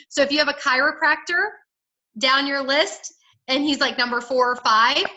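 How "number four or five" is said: ordinary delivery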